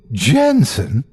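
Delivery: a sudden surprised cry of his name; startled exclaim. A narrator's reading. Dr. Prescott colliding with her co-worker, startled exclamation. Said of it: "exclaimed as the stack of papers".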